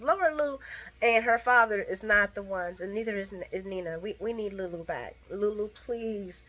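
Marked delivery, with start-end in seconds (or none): none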